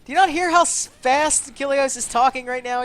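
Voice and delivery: loud, high, exasperated yelling; exasperated shout. Real, not acted. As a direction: yell it at the screen in disbelief. Caster shouting at teamfight chaos.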